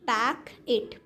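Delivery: पैक इट with a break between the parts; पैक, then इट; split into syllables. Shown as a demonstration. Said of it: "यहाँ पर words के syllable given हैं".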